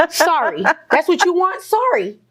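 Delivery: high-pitched sarcastic sorry; mocking apology. Escalating mocking apology, acted.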